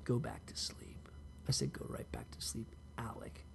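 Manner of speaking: sarcastic whisper to the brother; mock whisper. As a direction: whisper it like a bitter lullaby. Telling brother to go sleep.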